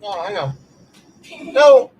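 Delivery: talking through a mouthful of cookie; words muffled around a bite; mouth full. Real, not acted. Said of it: "I can't really talk with my mouth full".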